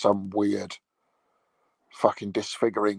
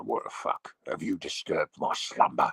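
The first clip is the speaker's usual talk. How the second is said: dog's grumpy voice; 7 semitones lower, 3 dB quieter than usual. Pretend. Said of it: "it looks at me and go".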